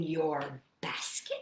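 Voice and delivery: slow hushed whisper; whispering the spooky line to the kids. Acted. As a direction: drop to a secret whisper. Spooky rhyme read to children.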